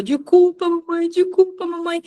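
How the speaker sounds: high-pitched baby-talk apology, imitated; apologetic toddler. Mom imitating her daughter hugging and apologizing, pleading toddler. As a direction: mimic a little child pleading for forgiveness. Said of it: "ela foi e me abraçou".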